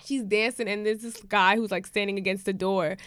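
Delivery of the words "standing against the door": normal talking voice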